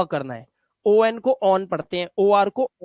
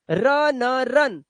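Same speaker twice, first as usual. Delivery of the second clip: slow spelling out; 3 semitones higher, much slower than usual. Shown as a demonstration. Teacher spells words slowly for pupils, sound by sound. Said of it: "अब इसे सुन मत पढ़ना".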